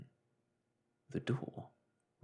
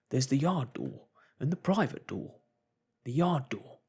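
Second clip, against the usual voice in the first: higher, louder urgent cry; alarmed realisation; 5 semitones higher, 7 dB louder than usual. Acted. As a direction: shout the danger. Barman realises doors open.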